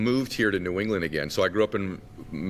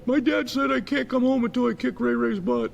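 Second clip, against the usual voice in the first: high mock-child voice; little boy. Little boy delivering dad's message to neighbor, high kid voice. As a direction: imitate a small kid at the door, pitched way up. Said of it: "And I said".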